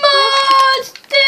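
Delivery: very high, loud call. Real, not acted. Boy calls one last time for his horse Musty, very loud. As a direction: shout the name as loud as you can.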